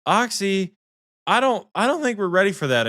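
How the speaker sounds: high, fast nervous whine; mock his timid warning; nervous Michael. Mimicking her cautious teammate, high and nervous. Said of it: "He's like".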